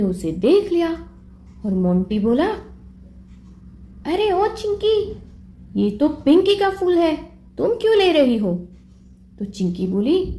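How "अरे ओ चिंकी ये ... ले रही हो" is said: put-on higher boy's voice, lightly accusing; a hint of a small boy calling out a friend; child Monty. Monty catching Chinki taking the flower, higher accusing voice.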